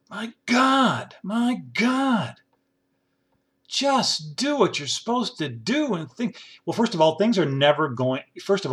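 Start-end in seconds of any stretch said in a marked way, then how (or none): 0.1-2.3 exasperated cry. Real, not acted. Exasperated outburst. 3.7-6.4 pleading. Real, not acted. Pleading with senators.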